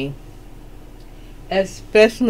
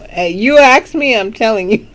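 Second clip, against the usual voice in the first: ordinary talk, nothing marked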